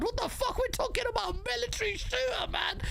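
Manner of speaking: real high-pitched outrage; exasperated rant. Real, not acted. Heated rant about shooter games.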